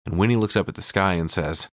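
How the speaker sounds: usual speaking voice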